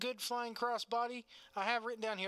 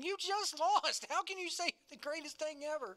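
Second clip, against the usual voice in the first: outraged disbelief; 7 semitones higher than usual. Retold or imitated. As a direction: cry out in shocked disbelief. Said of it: "Stutzy going".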